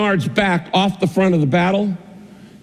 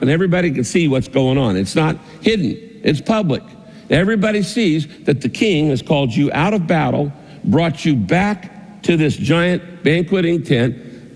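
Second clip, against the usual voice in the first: ordinary talk, nothing marked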